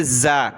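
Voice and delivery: زا with teeth together and a strong z; low, firmly buzzed زا; forceful buzzing z. Shown as a demonstration. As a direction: press the teeth and buzz into زا. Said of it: "دونوں دانتوں کو ملا کر زور کے ساتھ ادا کریں گے".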